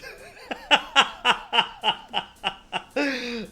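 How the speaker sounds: high falling laugh; can't stop laughing. Real, not acted. Streamer still laughing at the poor character.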